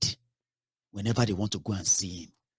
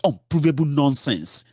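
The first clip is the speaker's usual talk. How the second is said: ordinary delivery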